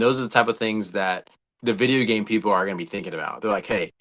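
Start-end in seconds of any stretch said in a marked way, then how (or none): none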